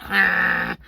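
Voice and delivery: loud, drawn-out mock snore; imitated snore. Mimicking partner snoring while she worked, loud mock snore. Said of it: "Gary was snoring so loud".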